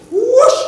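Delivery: loud, high whoosh of a swooping parent; mock whoosh. Parent swooping down from the stands, loud whoosh. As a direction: shout the swoop sound with a laugh.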